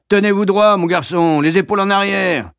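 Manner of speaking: loud, high military bark; drill-sergeant shout; barking order. Acted. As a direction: bellow at the slouching boy. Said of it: "et vocifère".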